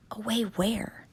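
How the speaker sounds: scared unvoiced whisper. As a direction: breathe the question, afraid. Frightened hushed question.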